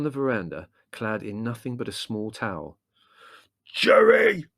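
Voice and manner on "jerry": high, very loud yell; full-force enraged shout; furious roar. A narrator's reading. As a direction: roar the name in rage. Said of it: "he roared".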